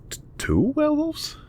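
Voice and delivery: high incredulous double take; stunned disbelief. Acted. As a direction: voice jumps way up in shock. Agent hears of two werewolves.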